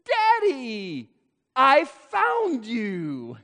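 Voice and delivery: high, slow and delighted, stress on "found"; a small boy beaming as he spots his dad; child's "I FOUND you"; toddler's voice. Retold or imitated. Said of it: "He said".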